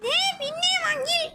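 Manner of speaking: high, fast, squeaky cartoon sidekick. Cartoon imp questioning the visitor, high and fast. Same character scoffs.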